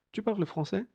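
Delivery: high-pitched model of "parles" as "parl"; silent ES ending. Shown as a demonstration. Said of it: "Veis que no se pronuncia".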